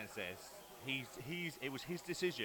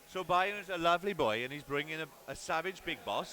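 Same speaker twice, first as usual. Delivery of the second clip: louder Welsh lilt; hammy Welsh storyteller; Welsh accent; 2 semitones higher, 3 dB louder than usual. Pretend. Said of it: "So I'll do the Welsh accent".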